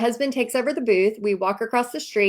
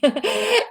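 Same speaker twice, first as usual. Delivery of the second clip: high giggle; 13 semitones higher than usual. Real, not acted. Laughing at tipsy memory.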